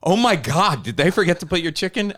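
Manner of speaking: waitress gasping at a missing patty; shocked waitress. Retold or imitated. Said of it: "And she's like".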